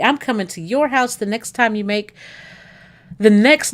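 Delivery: normal talking voice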